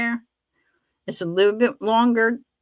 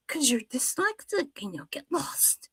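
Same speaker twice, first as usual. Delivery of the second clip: son's dry reply; 6 semitones higher, 9 dB quieter than usual. Retold or imitated.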